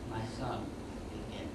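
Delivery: very soft, low poetic voice; hushed inner voice. Acted. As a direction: drop right down to a quiet, low command. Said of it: "and then the voice".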